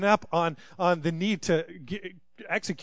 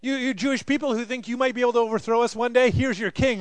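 taunting Romans; 7 semitones higher, 5 dB louder than usual. Acted. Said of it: "they're saying".

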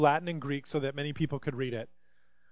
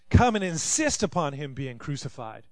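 usual speaking voice

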